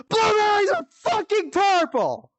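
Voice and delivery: high shouted puppet comic voice; high, loud, over-the-top puppet shout; channelling Randy Feltface. Pretend. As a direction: yell it like a manic puppet comedian. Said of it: "trying to channel Randy Feltface".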